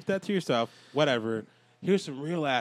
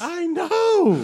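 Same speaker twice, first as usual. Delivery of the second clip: high squeal; 14 semitones higher, 7 dB louder than usual. Acted. Mock outrage over oppressed wildlings.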